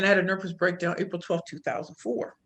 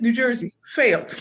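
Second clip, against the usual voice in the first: usual speaking voice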